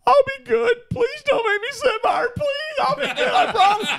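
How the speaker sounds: very high, loud mock-child wail; comic high-pitched pleading; pleading children. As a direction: beg like frightened kids, hammed up. Children begging not to sit by mom.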